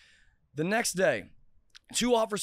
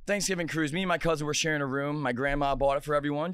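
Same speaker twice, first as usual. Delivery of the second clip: normal talking voice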